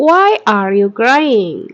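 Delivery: very slow and deliberate; slow model sentence. Shown as a demonstration. Teacher models the sentence slowly for students to repeat. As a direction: stretch every word for learners to copy. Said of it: "Repeat after me".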